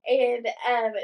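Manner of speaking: ordinary delivery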